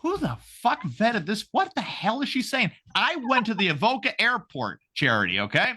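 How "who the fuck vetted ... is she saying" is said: disbelief at a bad candidate; high, slowed what-the-hell; incredulous inner voice. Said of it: "I'm like".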